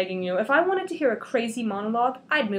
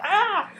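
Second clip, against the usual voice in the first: pained cry; 10 semitones higher, 6 dB louder than usual. Acted. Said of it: "And she's like".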